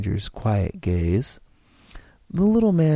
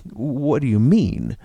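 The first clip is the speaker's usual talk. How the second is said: growled; 5 dB louder than usual. Acted. George growls at the stranger, slow and suspicious. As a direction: push back, suspicious and annoyed. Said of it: "George growled".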